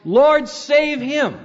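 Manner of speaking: high, slow acclamation, not truly shouted; joyous victorious shout. Retold or imitated. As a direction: proclaim it like a victory cry. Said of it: "victorious shout".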